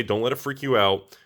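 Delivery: normal talking voice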